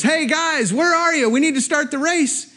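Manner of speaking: high-pitched, impatient official's call; imitated calling out. Race captain looking for riders, high calling voice. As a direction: round up the missing riders from afar.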